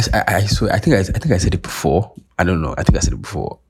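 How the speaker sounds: ordinary talk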